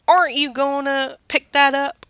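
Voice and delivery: light Southern accent for fun. Shown as a demonstration. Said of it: "with a very Southern accent".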